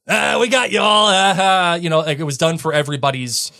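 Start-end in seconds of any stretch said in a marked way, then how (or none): 0.1-1.7 mock triumphant "gotcha"; gloating reveal. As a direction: crow it like a prankster revealing the trick. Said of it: "comes out and says".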